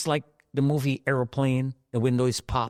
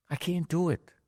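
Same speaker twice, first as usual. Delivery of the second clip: normal talking voice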